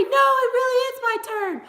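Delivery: put-on very high, fast whiny child; crying kid voice. Mom showing son how to fake-cry for a turn, whiny. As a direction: fake a tearful little kid's plea. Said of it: "You could cry and say".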